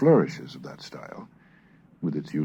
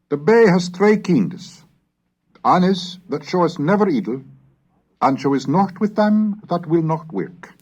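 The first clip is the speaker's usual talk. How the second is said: higher, louder declaimed reading; raised, ringing reading of an old northern text; Middle English recitation; 12 semitones higher, 6 dB louder than usual. Acted. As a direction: proclaim it like a medieval preacher.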